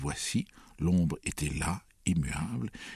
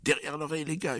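high-pitched hunting cry; alarmed, high urgent call; urgent yell; 11 semitones higher, faster than usual. A narrator's reading. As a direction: shout orders to the hunters. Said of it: "hurla-t-il".

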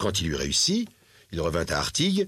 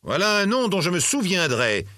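high, vengeful exclamation; vindictive cry; 8 semitones higher than usual. A narrator's reading. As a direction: cry it out as a threat, pitched up. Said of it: "s'écria le vieillard vindicatif". (exclamation vindicative, voix aiguë)

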